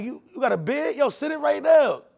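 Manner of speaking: high, loud thrilled outburst; excited disbelief. Retold or imitated. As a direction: jump on the news, thrilled. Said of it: "I'm like".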